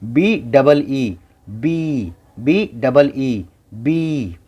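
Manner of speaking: slow, stretched letter by letter; drawn-out spelling. Shown as a demonstration. Teacher spelling a word slowly for students to copy. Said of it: "इस पे ध्यान दीजिए".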